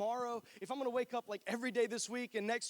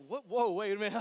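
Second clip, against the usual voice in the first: mock surprised; 7 dB louder than usual. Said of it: "and I'm like".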